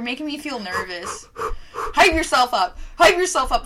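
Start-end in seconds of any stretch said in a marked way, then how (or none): 1.7-3.7 loud, high hype-up call. Real, not acted. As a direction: pump yourself up, loud and high. Psyching up before drinking.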